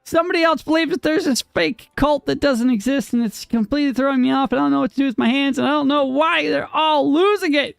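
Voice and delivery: high, fast frantic rant; hammed-up rising exasperation; mock meltdown. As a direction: rattle it off high like you're losing it. Mock breakdown about the fake cult.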